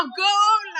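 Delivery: loud, high football cheer; goal shout. Retold or imitated. Fans yelling at the TV over a World Cup goal, loud shout. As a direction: yell it like a fan at the TV. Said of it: "gritamos a la tele".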